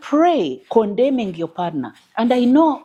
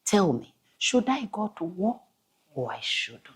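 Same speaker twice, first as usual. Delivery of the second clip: quiet prayer; 2 semitones lower, 6 dB quieter than usual. Retold or imitated. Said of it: "he would say".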